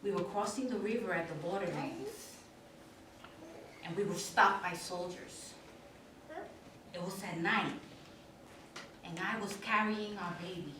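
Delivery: low, soft, grave telling; hushed recollection. Acted. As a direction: sink into a painful memory, low and soft. Recalls night flight at the border.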